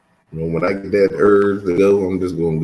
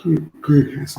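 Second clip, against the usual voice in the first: higher voice stretched by a yawn; 9 semitones higher than usual. Real, not acted. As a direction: talk through a yawn. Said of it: "I can't stop yawning".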